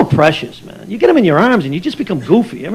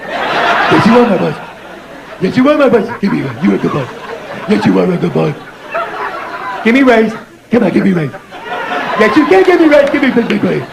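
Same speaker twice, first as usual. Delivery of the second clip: goofy, doting infant-directed babble; idiotic baby talk. Acted. Comic asking his baby boss for a raise, idiotic baby talk. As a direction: plead playfully, as if baby were boss. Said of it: "a license to talk like an idiot".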